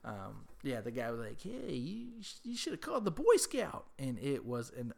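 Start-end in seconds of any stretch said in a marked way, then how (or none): 1.4-3.9 much higher put-on voice for the bragging neighbour; a high, smug imitation of the neighbour calling himself the Boy Scout; mock neighbour brag.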